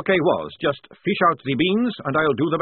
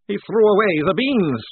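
louder, higher anguished moan; 11 semitones higher, 9 dB louder than usual. A narrator's reading. Maledict mourning his lost coffee beans, moaning. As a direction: wail it in despair.

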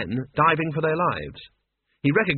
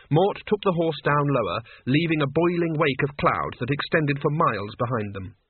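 plain reading voice

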